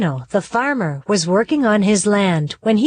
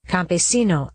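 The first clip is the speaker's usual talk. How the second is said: usual speaking voice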